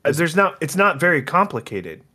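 high-pitched, insistent protest; yelling. Real, not acted. Insisting to co-host he knows all about it, yelling. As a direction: raise your pitch and insist. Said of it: "Why are you yelling at me?".

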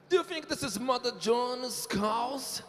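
high, drawn-out careful English; slow, high English. Shown as a demonstration. Host reads a riddle phrase slowly and high to Supla. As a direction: lift the voice and read slowly. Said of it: "O quê?".

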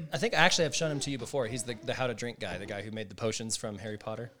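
usual speaking voice

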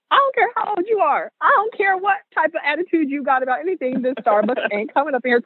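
scolding aunt, starting high and coming down; high-pitched, no-nonsense telling-off; Aunt Peggy. Acted. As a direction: lay down the law like a church aunt. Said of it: "Aunt Peggy was like".

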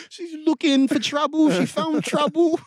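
high, slow, mocking British voice; hammed-up skeptical British cop; British accent, smug and accusing. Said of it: "they're always, like, skeptical".

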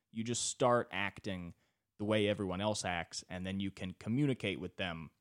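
ordinary talk

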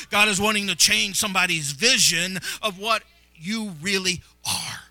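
loud, driving prophetic declaration; impassioned preaching. Real, not acted. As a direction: proclaim the promise at full force, slowing on the final words. Preaches restoration at full force.

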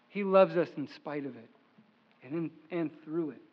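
a soft pastoral reassurance; tender reassurance. Real, not acted. Soft comfort after hardship.

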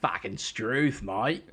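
put-on slow, drawn-out Australian accent; mock Australian exclamation; Australian twang. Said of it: "had a bit of a twang".

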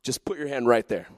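casual, reassuring Jesus; gentle invitation. Acted. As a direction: invite them kindly, voice dropped. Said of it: "No, he says".

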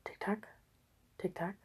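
barely voiced ticking; very soft. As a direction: hush the clock sound. Clock ticking. (ganz leise)

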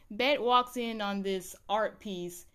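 normal talking voice

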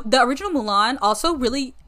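usual speaking voice

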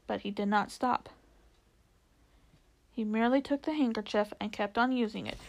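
plain reading voice

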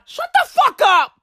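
high-pitched, mock-furious interruption; Johnny Depp. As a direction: cut the singer off in an exasperated high yell. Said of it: "That's Johnny Depp".

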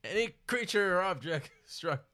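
hint of a Scottish dragon, laughing at first; Sean Connery dragon. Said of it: "That's the worst Sean Connery I've ever".

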